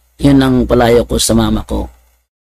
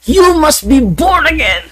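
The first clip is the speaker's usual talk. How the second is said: high, slow preacher's shout; pitched-up, slow mock sermon shout; pastor's shout; 13 semitones higher, slower than usual. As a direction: cry it across the street like the pastor. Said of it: "sigaw din si Pastor Dinoro".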